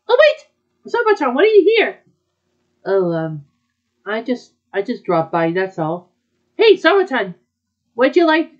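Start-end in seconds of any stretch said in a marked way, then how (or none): 0.1-2.0 high, loud, startled hello; louder and higher; surprised greeting. Acted. 6.6-8.5 higher, louder call across the room; bright invitation shouted over; calling a friend over for snacks; eager call. Acted.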